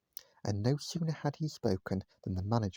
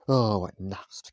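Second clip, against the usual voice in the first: slow, louder grumble; grumbling; 2 semitones lower, 7 dB louder, much slower than usual. A narrator's reading. Mister Grumble complaining to the waitress, slow grumble. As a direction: drag it out like an impatient grouch. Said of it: "grumbled Mister Grumble".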